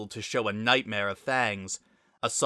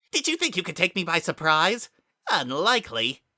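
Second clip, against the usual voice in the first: forced detachment; 7 semitones higher, 4 dB louder than usual. Acted. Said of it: "haze of hiss was back".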